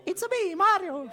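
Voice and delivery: high, squeaky Mario voice; Mario impression. Streamer does Mario for a viewer, high-pitched. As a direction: chirp it like Mario. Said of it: "Tu veux que je fasse Mario".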